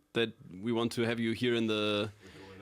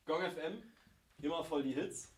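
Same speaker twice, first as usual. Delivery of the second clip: quiet slogan demo; 4 semitones higher, 9 dB quieter than usual. Shown as a demonstration. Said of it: "or you can say".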